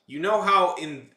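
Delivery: loud, high, drawn-out TikTok accent. Shown as a demonstration. Streamer starting a demo of how TikTokers talk, TikTok accent. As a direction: speak up slowly in a TikTok accent. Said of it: "like, speak up".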